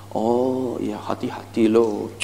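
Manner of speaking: low, soft caution; concerned. Shown as a demonstration. Salesperson telling an anxious customer to be careful, concerned. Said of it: "intonasi Anda harus concern".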